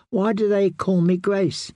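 put-on young princess asking, high and plaintive; a confused child pleading for an answer; plaintive girl. A narrator's reading. Confused young queen asks her protector, plaintive. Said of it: "she asked in a plaintive voice".

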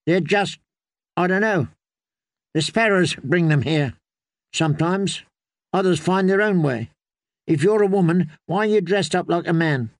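put-on girl's voice, matter-of-fact and guarded; wary young girl. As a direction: answer the strangers like a weary girl. Willow answers, then questions Brienne.

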